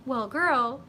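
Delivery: ordinary talk, nothing marked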